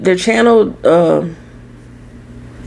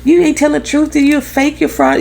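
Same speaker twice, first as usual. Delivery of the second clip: higher mocking voice of doubters; mocking commenters; 6 semitones higher than usual. Mocking comment-section doubters calling her a fraud, sassy. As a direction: sneer it like a sassy online critic. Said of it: "in the comment section was like".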